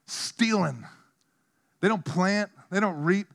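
ordinary talk, nothing marked

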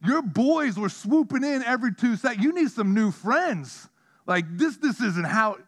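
hammed-up rant at the greedy birds; mock scolding. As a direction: scold the birds in fun, playing mad. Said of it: "I'm mad 'cause I'm like".

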